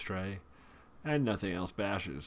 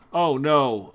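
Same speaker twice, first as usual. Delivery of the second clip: louder oh no, alarmed; loud dismay; 5 semitones higher, 13 dB louder than usual. Real, not acted. Player sees opponent's double spell, loud dismayed oh no. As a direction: cry out as the bad card lands.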